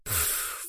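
short, voiceless "pff"; derisive snort. A narrator's reading. Nova scoffs at what Henry said, with a snort. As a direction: puff out a dismissive snort. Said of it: "фыркнула Нова".

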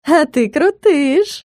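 slow, high, gushing praise; flirty praise. Acted. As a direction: gush over him, drawn out. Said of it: "похвалила она".

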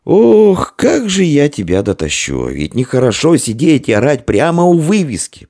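high, strained wail of complaint; innkeeper's anguished moaning, no whisper; exasperated lament. A narrator's reading. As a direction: plead in despair with a drunk. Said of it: "прошептал хозяин".

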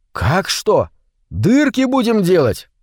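bouncing back, pitch leaping up at the end; undaunted. A narrator's reading. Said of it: "не унывал Витя". (не унывая)

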